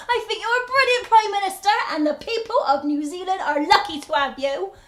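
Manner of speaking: loud, cheerful, laughing welcome; friendly shout. Acted. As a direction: shout a hearty greeting across the garden. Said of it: "I say".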